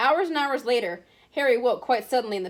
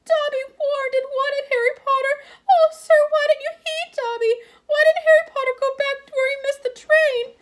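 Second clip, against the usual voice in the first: high, squeaky, loud elf voice near tears; tearful house elf; 7 dB louder than usual. A narrator's reading. House elf reproaching Harry, squeaky and tearful. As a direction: plead like a weeping servant elf.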